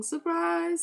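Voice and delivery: high sing-song surprise; taunting party-reveal surprise; gleeful exclaim. Real, not acted. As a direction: tease them with a bright reveal. Repeated taunt to the haters.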